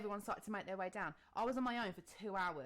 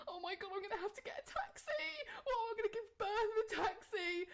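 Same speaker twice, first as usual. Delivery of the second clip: much higher, fast, panicky; mock panic; 12 semitones higher than usual. Thinking she must take a taxi, high fast panicked voice. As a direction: rush it out in a flustered panic. Panic about getting to hospital.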